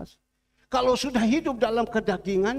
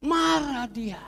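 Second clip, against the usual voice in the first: emphatic; 4 semitones higher, slower than usual. Shown as a demonstration. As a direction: punch it out the second time. Jesus angry at fig tree.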